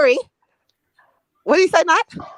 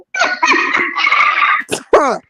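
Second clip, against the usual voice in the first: breathy laugh; 6 dB louder than usual. Real, not acted. Laughter at Orlando punchline.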